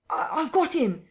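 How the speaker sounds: high stammered scream; frantic shriek. A narrator's reading. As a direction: scream out the catch in triumph and fear. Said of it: "screamed Kemp".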